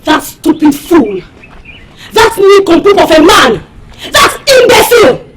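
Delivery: angry name-calling, building to a shout; indignant venting, rising in pitch; harsh insults. Acted. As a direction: spit out each insult, climbing. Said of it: "[name], that's harsh".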